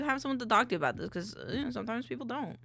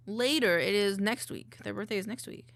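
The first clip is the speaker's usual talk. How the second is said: normal talking voice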